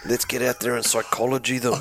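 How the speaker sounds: mock rallying cry through laughter; laughing mockery. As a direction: say it laughing, mocking the phrase. Mocking the fan's phrase.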